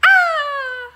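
high piercing bird screech; pterodactyl screech. Shown as a demonstration. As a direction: shriek like a pterodactyl. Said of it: "when you wanna switch it the reverse way".

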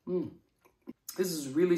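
chewing while talking; speaking between bites; mouth full. Real, not acted. Seen 0: Bites squash at -2; jaw and lips chew through early words.

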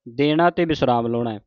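ordinary delivery

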